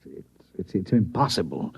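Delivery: breathless, unvoiced stammer of shock; a shaken whisper of disbelief; stunned disbelief. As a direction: stumble over it, winded by the news. President stunned by doomsday news.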